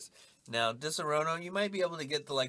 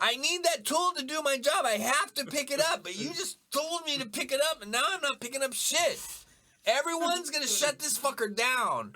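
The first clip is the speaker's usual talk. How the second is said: high-pitched, escalating rant; mock outraged worker; 11 semitones higher, 3 dB louder than usual. Worker refusing an order to pick up his tool, high mock outrage. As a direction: rant it as a hammed-up grievance.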